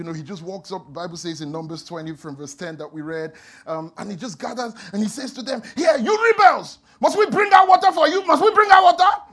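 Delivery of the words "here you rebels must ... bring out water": high, loud angry roar at the rebels; furious shout. Acted. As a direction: bellow it at the crowd. Moses rages at Israelites.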